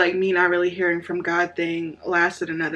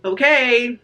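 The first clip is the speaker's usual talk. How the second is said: impatient snark, pitch a bit raised; snarky; 5 semitones higher, 5 dB louder than usual. Retold or imitated. Praying to God as confidence dwindles, snarky. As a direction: retell it as a snarky demand. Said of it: "it kinda turned into snarky".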